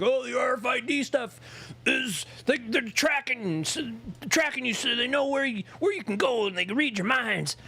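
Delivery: high, whiny paranoid mocking voice; mock conspiracy theorist. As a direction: whine it like a paranoid crank. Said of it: "It's like, conspiracy theorists".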